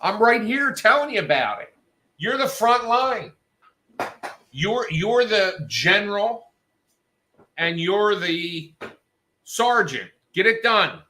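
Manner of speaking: high, loud scolding, slowing near the end; retold angry rant. Telling the manager to fix it himself, angry. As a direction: lay into him, furious. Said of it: "I said".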